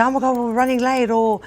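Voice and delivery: flustered wife hurrying everyone along; mock panic.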